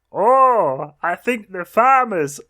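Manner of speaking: high, silly put-on duck voice; Dinky the Duck. Duck deciding to return the farmer's ladder, silly high voice. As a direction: pitch it up like a dopey storybook duck. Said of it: "don't like Dinky's voice".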